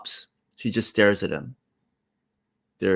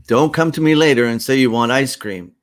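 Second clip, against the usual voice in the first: loud, raised-pitch scolding; parental warning; 5 semitones higher, 7 dB louder than usual. Acted. Parent warning Queenie about ice cream, loud and scolding. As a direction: warn the child with plenty of volume. Parent warns refusing child.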